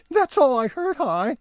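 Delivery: very high elf voice; Barnaby insisting, very high voice. Acted. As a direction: shrug it off. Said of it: "said Barnaby".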